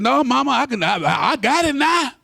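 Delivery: high, whiny protest to Mama; protesting son. Retold or imitated. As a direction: brush off his mother. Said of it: "I said".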